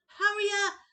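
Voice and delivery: high, loud desperate shout; desperate beg. Acted. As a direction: beg before going under. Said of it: "Colin started to beg".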